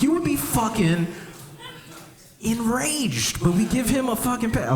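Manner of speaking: high outraged punchline; mock outrage. Real, not acted. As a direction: rant at the unfairness. Mock outrage at double standard.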